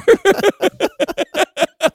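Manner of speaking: high-pitched burst of real laughter; long, high run of laughter; laughing. Real, not acted. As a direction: crack up helplessly at your own bit. Cracking up over own bit.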